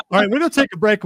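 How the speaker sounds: fast, bright break announcement; upbeat radio host. Real, not acted. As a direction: wrap it up quickly and upbeat.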